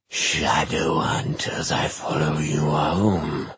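slow, drawn-out otherworldly; an eerie voice from everywhere; eerie demon voice. Acted.

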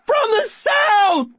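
very high, throat-tearing yell; cracking scream. Acted. Boy screaming to the unhearing room, cracking scream. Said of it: "I scream so loud my voice is cracking".

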